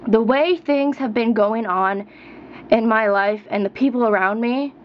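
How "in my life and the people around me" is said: ordinary talk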